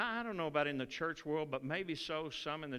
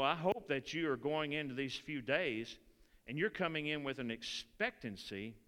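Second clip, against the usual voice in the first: ordinary delivery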